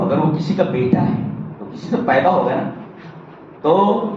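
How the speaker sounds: normal talking voice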